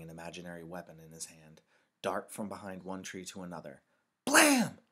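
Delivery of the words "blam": high, loud pretend gunshot; boy's gun noise. A narrator's reading. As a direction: shout a short explosive bang like a boy at play. Boy shooting in war game.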